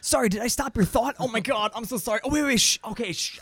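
high, flustered, over-apologetic chatter; apologetic interrupter. Retold or imitated. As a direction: babble sorry while still interrupting. Imitating an interrupting human.

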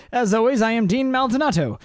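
high-pitched put-on host voice; hammed-up sign-off. Host closing the show for listeners, high mock-formal sign-off. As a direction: sign off in a silly high register.

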